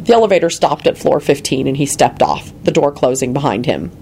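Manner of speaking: usual speaking voice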